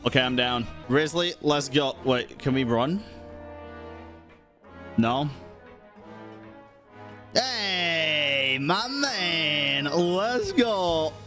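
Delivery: ordinary talk, nothing marked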